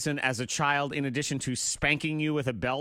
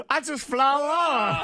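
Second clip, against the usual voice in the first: imitating quote; 9 semitones higher, 6 dB louder, much slower than usual. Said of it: "That's a good quote".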